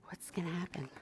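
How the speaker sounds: suspense whisper. Real, not acted. Reading to the child, whispering to build suspense. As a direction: drop to a secret whisper. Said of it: "reader builds suspense".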